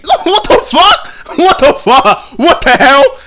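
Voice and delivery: high, loud laughing what the fuck; laughing disbelief. Real, not acted. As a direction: laugh it out in shock.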